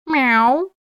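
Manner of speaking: high cat announcement; triumphant meow. A narrator's reading. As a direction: meow like a cat making its entrance. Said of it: "триумфально объявил". (торжествующее мяуканье)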